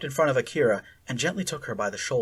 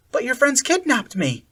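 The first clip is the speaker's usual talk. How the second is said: high, louder cracking protest; 11 semitones higher, 4 dB louder than usual. A narrator's reading. Akira accusing Sally Ann, voice cracking. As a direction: near tears, voice breaking. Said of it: "Akira's voice cracked".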